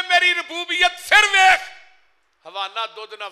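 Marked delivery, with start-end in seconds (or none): 0.0-1.6 high, full-force preaching shout; thundering proclamation. Acted. Preacher voicing God displaying His lordship, high shouted proclamation. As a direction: proclaim it at the top of the voice.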